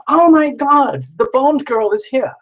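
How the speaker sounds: high excited squeal of welcome; excited gasp. Real, not acted. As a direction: greet her like a celebrity just walked in. Excited greeting of viewer.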